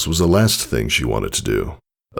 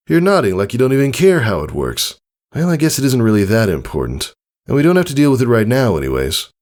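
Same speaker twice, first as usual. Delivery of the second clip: smirking tease; 7 semitones higher, faster than usual. Acted. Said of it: "Tycho smirked at her".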